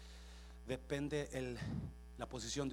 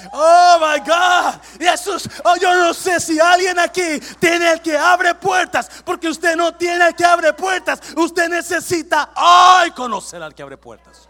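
high, very loud drawn-out shouting; full-force preaching; 27 dB louder, slower than usual. Real, not acted. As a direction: cry it out to the whole congregation. (predicación a gritos)

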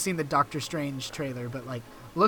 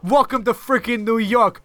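higher-pitched mock New York accent; different accent; 5 semitones higher than usual. Podcaster welcomes listeners in a put-on New York accent. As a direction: ham up a brash New Yorker accent. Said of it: "with a different accent".

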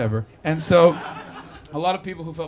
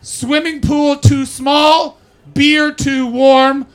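very slow, loud, high proclaiming; slow, loud shouted list; deadpan review read loud; 8 semitones higher, 12 dB louder, much slower than usual. Retold or imitated. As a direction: bark each complaint like a headline. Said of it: "the review was this".

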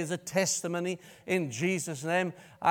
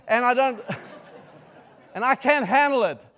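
loud, high, rising preacher's climax; preaching at full force; 7 semitones higher, 8 dB louder than usual. Acted. Preacher building his point loud and high, ending in a laugh. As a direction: drive it home to the congregation.

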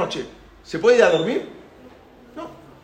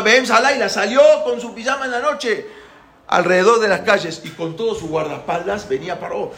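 ordinary talk, nothing marked

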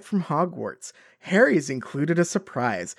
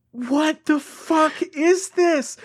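slow, higher, incredulous; exasperated disbelief; 10 semitones higher, slower than usual. Real, not acted. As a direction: drag it out in disbelief, pitched up. Reacting to absurd book passage.

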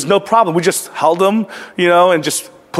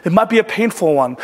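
ordinary talk, nothing marked

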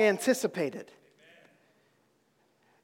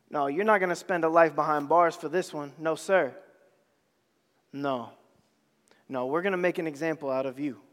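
low, fast and cold, a judge handing down death; lower, clipped voice of the accusers; stern sentencing voice; 9 semitones lower, 5 dB quieter, faster than usual. Acted. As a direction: play the accusers passing sentence.

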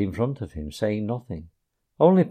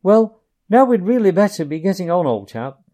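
careless remark; 7 semitones higher, 7 dB louder than usual. A narrator's reading. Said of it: "remarking carelessly".

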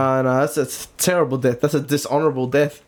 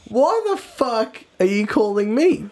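higher, drawn-out incredulous complaint; mock-annoyed; 5 semitones higher, slower than usual. Friend woken by an early morning call, incredulous. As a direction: slowly demand why, exasperated. Retells reaction to late-night call.

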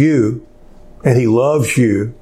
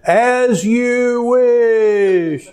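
drawn-out, high, laughing call of the line; called out while rolling; 9 semitones higher, much slower than usual. Retold or imitated. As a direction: stretch it out long and high with a laugh as he tumbles down. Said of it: "he says".

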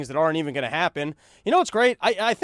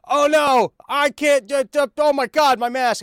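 shrill, much higher, very loud hammed-up freakout; mock panic; 9 semitones higher, 7 dB louder than usual. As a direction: shriek like a kid caught unmasked. Mocking unmasked guy's panic.

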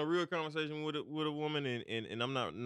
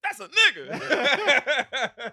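high-pitched howling laugh; loud laughter; 12 dB louder, much slower than usual. Real, not acted. Big laugh, high sung cry.

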